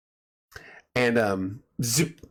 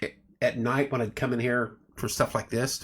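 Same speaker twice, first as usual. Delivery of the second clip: ordinary delivery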